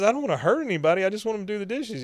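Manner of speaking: high-pitched, hurried mock protest; protesting niece. Host as Luann answering Dale's scheme, high mock protest.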